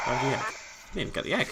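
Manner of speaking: usual speaking voice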